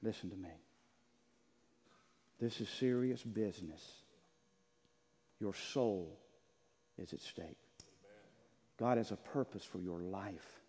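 hushed, low, slow preaching; solemn appeal. Real, not acted. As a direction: bring it right down, grave and earnest. Solemn closing appeal to congregation.

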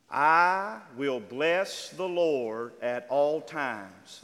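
very slow, loud, every word weighed; slow reading aloud. Shown as a demonstration. Preacher leads the church in reading the psalm, slow and loud. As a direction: lead the congregation through the verse word by word. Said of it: "I'm gonna read it slow".

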